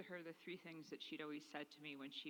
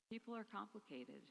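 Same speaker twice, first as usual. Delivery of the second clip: normal talking voice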